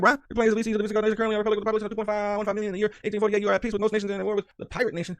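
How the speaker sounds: a hint of an official advisor voice, sped up; mock advisor report. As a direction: rattle off the advisor's summary in a lightly put-on voice. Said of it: "That's what they just said to me".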